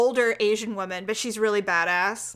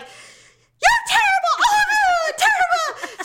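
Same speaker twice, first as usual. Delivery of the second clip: much higher, louder, slow laughing yell; mock scolding shout; 5 dB louder, slower than usual. Owner reenacts yelling at her runaway dogs, laughing shout. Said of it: "I'm like".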